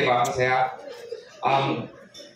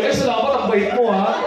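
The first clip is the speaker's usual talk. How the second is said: higher, sweet grateful voice; mock grateful woman; 6 semitones higher than usual. Woman at the ATM thanking a stranger in a higher sweet voice.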